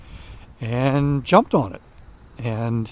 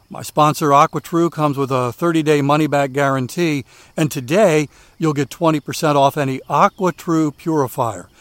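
upbeat, louder sponsor read; 2 semitones higher, 5 dB louder than usual. Acted. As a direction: sell it like a radio ad. Host reads sponsor ad.

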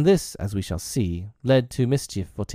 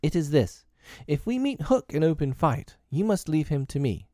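put-on higher-pitched boy leader laying down the law; commanding boy captain; 8 semitones higher than usual. A narrator's reading. Peter demands John's promise about Hook, commanding boy captain. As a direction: give a young captain's order.

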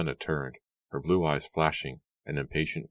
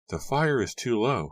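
raised, higher-pitched disapproval; mother's disapproving remark; 8 semitones higher, 3 dB louder than usual. A narrator's reading. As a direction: find fault in a high voice. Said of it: "shaking her head disapprovingly".

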